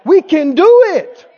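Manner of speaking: loud, high rallying shout; faith talking. Acted. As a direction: declare it with absolute confidence. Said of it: "That's how faith talks".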